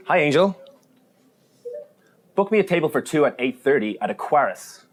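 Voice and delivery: loud, high, clear assistant command; talking to voice assistant. Shown as a demonstration. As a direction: speak up and spell it out for the assistant. Booking table via voice assistant.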